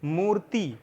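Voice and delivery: मूर्ति with a long ऊ and the र sounded before ति; pronunciation demo. Shown as a demonstration. Teacher sounding out मूर्ति for students to copy the reph. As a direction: model मूर्ति for pupils, long मू then र before ति. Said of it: "इसको पढ़िए तो क्या हो जाएगा?".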